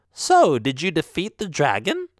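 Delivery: put-on knowing old witch asking; sly witch question. Voices the witch.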